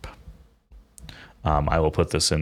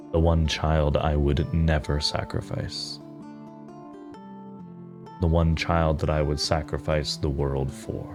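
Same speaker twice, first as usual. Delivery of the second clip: solemn mother figure; 6 dB quieter than usual. Acted. Game master as a determined mother to her child, solemn and measured. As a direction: confide a life's purpose with deliberate pauses.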